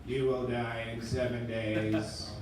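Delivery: low, soft, slow ominous voice; creepy phone voice. Retold or imitated. Caller from The Ring threatens him, low slow and quiet. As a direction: menace them slowly and quietly. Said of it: "the voice on the other line was like".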